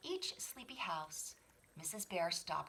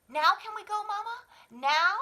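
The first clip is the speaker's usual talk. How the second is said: begging cub; 14 semitones higher, 12 dB louder than usual. Acted. Child begging mother.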